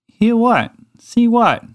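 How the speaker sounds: high puzzled little mouse. Acted. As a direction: ask like a baffled small mouse.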